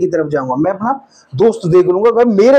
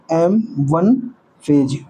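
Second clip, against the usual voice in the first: term drawn out slowly and lower; slow dictation; 4 semitones lower, much slower than usual. Real, not acted. Teacher writing out the phase name, slow dictation. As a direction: say it word by word for note-taking.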